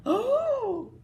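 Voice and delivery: high, loud smitten oh; prince's amazed oh. Acted. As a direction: struck by her beauty.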